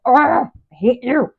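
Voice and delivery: a hammed-up burst of annoyance; mock frustration. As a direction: blurt it out in playful exasperation. Voicing frustrated partner's outburst.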